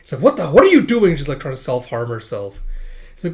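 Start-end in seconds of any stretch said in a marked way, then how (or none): 0.2-1.1 loud, high startled demand; alarmed outburst. Acted. Boy seeing her hurt herself, alarmed outburst. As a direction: cut in with sudden alarm. Alarm at girl self-harming.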